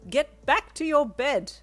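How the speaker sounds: high, loud, slow command; loud stern nurse command; authoritative yell. Acted. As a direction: order him back firmly. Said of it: "an authoritative voice yelled".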